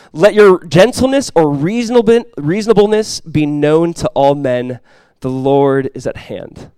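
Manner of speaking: emphatic pulpit reading, slowing to the close; scripture reading. Acted. Pastor reading the verse to the congregation, emphatic then slowing scripture reading. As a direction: proclaim the verse loudly, then settle slowly.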